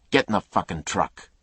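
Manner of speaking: grabbing her coat, furious; angry order. Acted. Attacker dragging woman.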